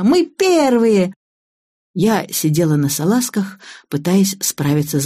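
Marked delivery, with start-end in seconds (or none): 0.0-1.1 put-on high, slow, triumphant child; cheering girl. A narrator's reading. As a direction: draw out the high victory cry. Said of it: "закричала она". (протяжно, высоко, торжествуя)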